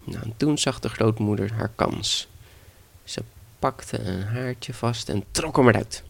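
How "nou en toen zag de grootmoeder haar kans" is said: ordinary delivery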